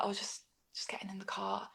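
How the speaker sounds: hushed, near-whispered excuse; sheepish guest. Retold or imitated. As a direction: imitate a guest caught out, very softly. Guest caught sitting in the cart.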